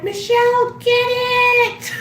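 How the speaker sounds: high, loud frantic cry to her sister; frantic call. Retold or imitated. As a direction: yell for her to grab it, panicking. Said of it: "And I was like".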